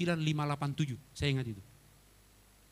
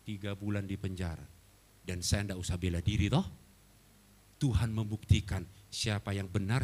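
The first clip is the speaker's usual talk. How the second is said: ordinary delivery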